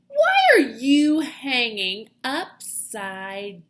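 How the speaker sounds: loud, slow questioning bat. Acted. Bat questioning Stellaluna, loud and slow. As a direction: boom the question out slowly. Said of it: "a loud voice said".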